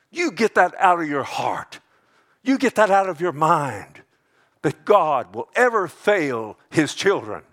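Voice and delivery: loud, high-pitched exhortation; forceful command. Real, not acted. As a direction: order it out of them, loudly. Preacher commands the congregation.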